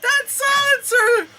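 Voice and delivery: high, slow, shouted delight; real excited yell. Real, not acted. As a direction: cheer at the gadget on screen. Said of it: "excited shout".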